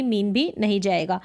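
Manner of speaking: ordinary delivery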